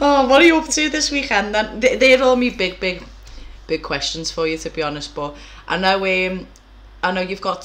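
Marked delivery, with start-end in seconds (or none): none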